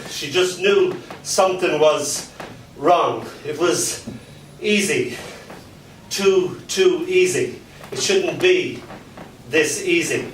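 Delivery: rhythmic spoken-word delivery; performance poem. Acted. Poet reciting dark fairy tale to a crowd.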